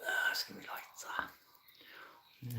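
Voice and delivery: soft unvoiced whisper. Real, not acted. Streamer whispering an aside to himself, hushed. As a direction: breathe it under your breath.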